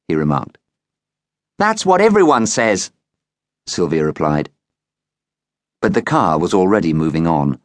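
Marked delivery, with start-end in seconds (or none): 1.6-2.9 put-on girl's voice. A narrator's reading. Sylvia replies to the man.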